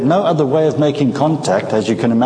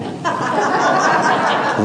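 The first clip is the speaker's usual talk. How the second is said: high airy chuckle; a real, breathy laugh at himself. Real, not acted. Guest laughs remembering being a young pilot, high airy chuckle.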